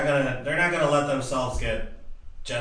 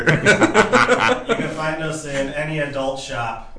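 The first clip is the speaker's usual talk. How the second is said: laughing; 4 semitones higher, 8 dB louder, slower than usual. Real, not acted. Joking sign-off, laughing.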